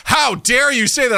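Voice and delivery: high, slow, loud indignation; imitating a scandalized guest; mock outrage. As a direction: act the affronted customer, stretching each word. Imitating customer told card failed.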